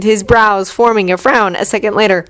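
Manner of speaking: usual speaking voice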